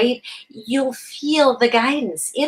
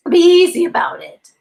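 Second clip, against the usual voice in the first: usual speaking voice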